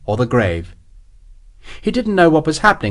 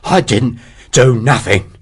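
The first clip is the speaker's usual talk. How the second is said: laboured breathing. Acted. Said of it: "through his laboured breathing".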